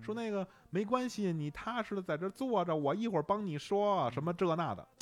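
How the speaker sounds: mimicking a Hong Kong attendant's comforting accented Mandarin; Hong Kong auntie.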